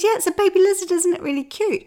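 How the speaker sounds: put-on high, loud gushing child voice; delighted child. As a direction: show off the lizard like a little girl. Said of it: "She says".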